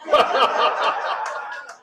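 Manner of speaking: breathy high laugh after the joke; airy laugh. Real, not acted. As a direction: laugh out at your own line. Room laughs at her quip.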